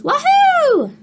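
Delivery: very high, loud joyful wahoo; whooping. Acted. Duck thrilled driving, loud high whoop. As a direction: whoop with pure joy.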